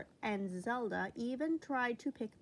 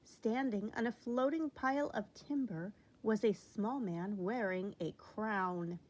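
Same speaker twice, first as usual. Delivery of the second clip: ordinary delivery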